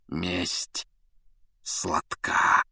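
soft, gloating whisper. A narrator's reading. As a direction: breathe it out, savouring revenge. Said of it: "шепнул Снег Блэку".

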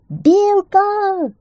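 very high, loud sustained call; piercingly high holler; shouting. A narrator's reading. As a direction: yell out for a friend far away. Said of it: "закричал заяц".

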